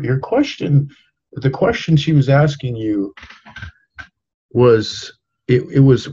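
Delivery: ordinary delivery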